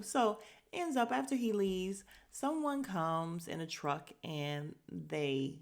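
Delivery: ordinary talk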